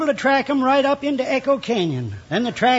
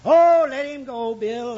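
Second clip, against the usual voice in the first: ordinary delivery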